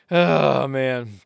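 ordinary talk

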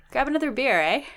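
light mock Canadian accent; a hint of Canadian eh. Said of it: "Is that your Canadian accent?".

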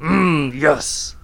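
put-on cheesy Russian accent on a podcast. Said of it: "I can't do a Russian accent".

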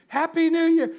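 high, loud New Year cheer; big party cheer at midnight; new year countdown crowd. Pretend. Repeated cheer.

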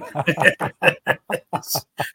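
laughing at his own joke; a genuine amused laugh; real laugh. Real, not acted. Guest laughs at his own cheeky prayer, real laughter.

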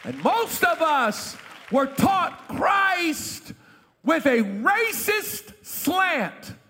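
fiery pulpit preaching; preaching at full force. Acted. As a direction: preach it out to the congregation. Preacher rising over organ music.

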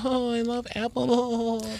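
high, slow, breathy-cute gushing; mock a sugary couple cooing; hammed-up cute girlfriend voice; cutesy couple voice. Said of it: "couples who go just to be cute".

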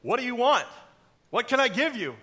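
loud, high, pleading demand; hammed-up urgent questioning; impatient king. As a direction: burst out as a king dying to know. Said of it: "anticipation is killing me".

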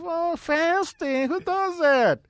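high, slow incredulous "Oh, fasting?"; mocking disbelief. Victor imitates his buddy scoffing at his fast, high and slow. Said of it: "And he's like".

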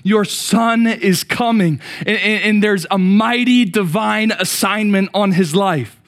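loud, high, fervent preaching; full-force preaching. Acted. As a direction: preach it out, building the fire.

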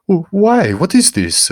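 high, loud startled stammer; frightened stammer. A narrator's reading. Guido Baldo startled by the boy's touch, panicked stammer. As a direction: blurt it out in panic, tripping on the start. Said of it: "Guido Baldo stammered".

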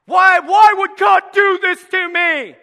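loud whiny shout at God; mock angry complaint. Believer complaining to God, shouted. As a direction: hurl it upward, aggrieved. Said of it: "we get mad at God".

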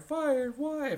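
high, startled mock Homer voice; shocked Homer. Homer hears he's fired, high startled mock voice. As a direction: squeak out the question in disbelief.

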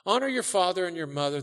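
ordinary delivery

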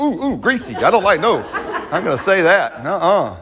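high, laughing mock disgust at saying it; mock squeamish refusal. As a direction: playfully act out the congregation recoiling from the words. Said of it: "you're like".

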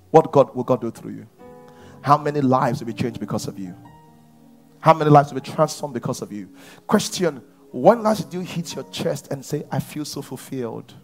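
ordinary delivery

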